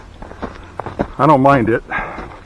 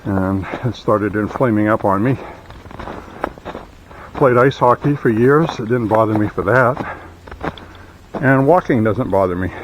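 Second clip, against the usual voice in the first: usual speaking voice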